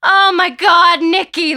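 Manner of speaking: loud, high-pitched cry of dismay; alarmed cry. A narrator's reading. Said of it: "Lydia cries".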